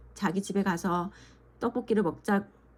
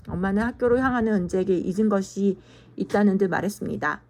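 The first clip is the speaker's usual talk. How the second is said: plain reading voice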